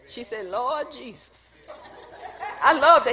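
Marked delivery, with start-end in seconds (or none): 0.5-1.5 "Lord Jesus" slow, soft and low; imitated emphatic exclamation. Preacher echoing Sister Janet, "Lord Jesus" slow and hushed. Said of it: "Say it like you just said it".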